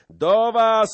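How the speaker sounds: very high, loud holler; full-voiced rallying cry; calling out. A narrator's reading. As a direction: call across the crowd. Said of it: "wołał Skołuba".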